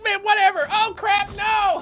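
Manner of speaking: very high, comic goat voice; goat's voice. Acted. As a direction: voice a careless goat that suddenly panics. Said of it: "I like how the goat doesn't even run away".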